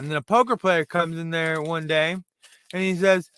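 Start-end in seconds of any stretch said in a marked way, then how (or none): none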